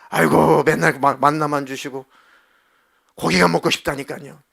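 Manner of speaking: loud, nagging plea for meat; whining complaint. Acted. Israelites nagging God for meat, loud whining. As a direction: grumble loudly like a hungry crowd.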